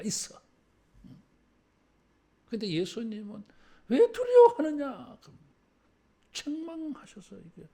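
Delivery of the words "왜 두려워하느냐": 책망하는 예수님. Acted. 예수님의 책망을 연기함.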